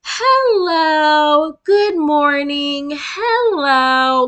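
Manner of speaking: high, loud, slow singsong greeting; mock a cheery children's show host; sugary kids' host. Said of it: "You not finna".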